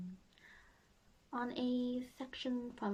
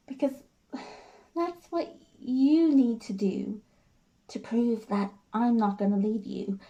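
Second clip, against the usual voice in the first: earnest, wounded devotion; Jenny; 3 semitones higher, 15 dB louder than usual. Acted. Jenny tells George she will take his abuse, pained resolve. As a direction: plead with deliberate pauses, swelling on the hurt.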